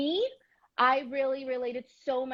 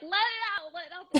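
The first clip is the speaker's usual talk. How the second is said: high-pitched mock coaxing cheer; retold urging on; 7 semitones higher, faster than usual. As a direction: egg her on from afar, voice pitched up high. Retold cheering her on while watching.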